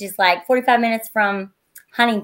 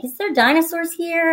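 slightly raised, slow voice of a son asking; imitating young son; 6 semitones higher than usual. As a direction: lightly voice your kid's question. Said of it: "my son's like".

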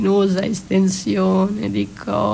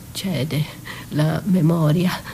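ordinary talk

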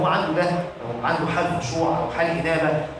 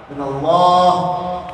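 الله stretched out long, louder and half-sung; drawn-out emphasis; 6 dB louder, much slower than usual. Real, not acted. Preacher saying fear must be of God alone, الله drawn out with emphasis. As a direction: hold الله on one long, swelling note. Climax of list on fearing God.

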